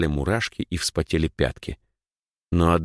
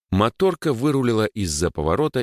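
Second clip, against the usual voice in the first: ordinary talk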